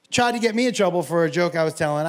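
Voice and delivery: usual speaking voice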